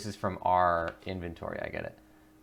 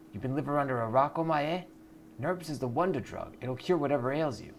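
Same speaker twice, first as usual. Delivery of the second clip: higher, fast salesy dealer pitch; hyped dealer; 6 semitones higher than usual. Acted. Dealer scoffs and sells nerps, higher hustler pitch. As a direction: sell it like a street hustler.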